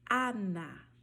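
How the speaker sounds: Ana with open, unnasalized A; open Spanish Ana. Shown as a demonstration. Teacher modeling Spanish Ana for students, open A. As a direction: say Ana with a clear open A, no nasal. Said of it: "você vai falar".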